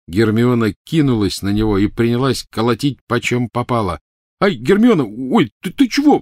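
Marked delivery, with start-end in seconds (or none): 4.4-6.2 put-on high, loud yelps of a boy being hit; startled yelp. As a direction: flinch and cry out as the blows land.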